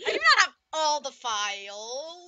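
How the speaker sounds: loud, slow, stressed NOT; emphatic denial. Real, not acted. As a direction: hit "not" hard and slow. Insisting denial.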